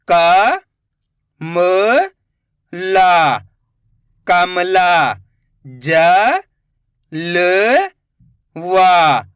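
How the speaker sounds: each syllable drawn out, then the whole word; slow spelling-out teacher voice. Shown as a demonstration. Teacher sounding out Hindi syllables for children, slow and drawn out. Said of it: "teacher sounds out syllables".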